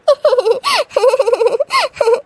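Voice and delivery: long cackling giggle fit; gleeful laughter. Acted. Bhabhi laughs after sister-in-law's angry vow, gleeful giggling. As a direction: laugh gleefully at her threat.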